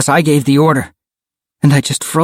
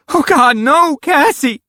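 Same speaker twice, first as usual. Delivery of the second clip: high, distressed wail; anguished cry; slower than usual. A narrator's reading. Rachel realizes her friend is lost, crying out. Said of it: "Rachel cried".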